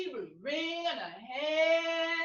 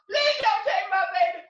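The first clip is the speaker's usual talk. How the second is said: put-on begging out loud, mother clinging to her baby; loud plea; 4 semitones higher, 7 dB louder than usual. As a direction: plead with the buyer. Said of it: "tears were falling down her face".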